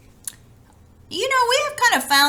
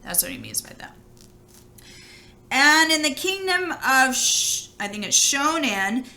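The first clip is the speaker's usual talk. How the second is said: ordinary delivery, nothing marked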